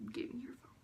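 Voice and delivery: imitating mom in a whisper; whispered demand. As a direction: whisper the order, barely any voice. Mom's look before taking phone.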